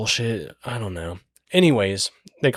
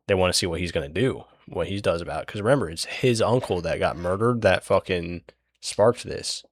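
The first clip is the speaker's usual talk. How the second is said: normal talking voice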